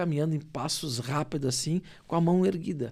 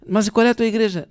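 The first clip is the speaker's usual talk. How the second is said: higher, louder retold questioning; insistent question; 7 semitones higher, 7 dB louder, faster than usual. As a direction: press for an answer, voice raised. Retells his insistent question to woman. (pergunta insistente, mais alta e aguda)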